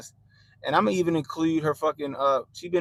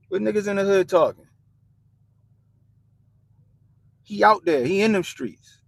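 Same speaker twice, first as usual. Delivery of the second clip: loud, higher hail across the room; calling out; 6 semitones higher, 8 dB louder than usual. Real, not acted. Streamer loudly hailing his friend Cosmo in the room, calling out. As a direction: shout over to Cosmo, a friend nearby.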